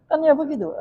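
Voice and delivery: laughing. Real, not acted. Laughing repeat of catchphrase.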